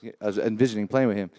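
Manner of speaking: close-miked repeat; closer to the mic. Real, not acted. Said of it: "Keep that, keep that mic close".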